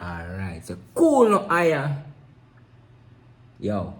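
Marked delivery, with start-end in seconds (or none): 0.9-1.9 very high, louder patois; Jamaican accent. Acted. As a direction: sing it out island style. Patois phrase, playful.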